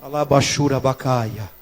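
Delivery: low, soft, slow glossolalia; hushed prayer in tongues; speaking in tongues. Real, not acted. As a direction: utter strange syllables slowly and low. Preacher slips into tongues mid-sermon.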